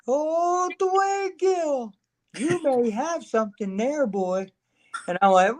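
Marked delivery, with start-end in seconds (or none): 0.1-4.5 high, drawn-out mock Paul Bearer voice; a much higher voice for the wrestling manager who names the gimmick; imitating Paul Bearer. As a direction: put on the old manager's high voice, teasing.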